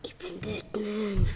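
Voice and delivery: slow breathy whisper; second Batman whispers his name. Acted. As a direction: whisper it slowly like a secret hero. Second Batman toy speaks.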